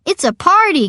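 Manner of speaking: high, loud party cry; excited cry. A narrator's reading. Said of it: "cries Uncle Frankie".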